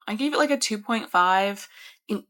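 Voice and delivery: ordinary delivery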